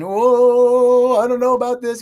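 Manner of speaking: high, loud worried whine; mock the nervous inner voice with a jumpy cry; anxious emotional voice. Speaker voicing the listeners' fretful emotional self, high and worried. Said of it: "your emotional body is saying".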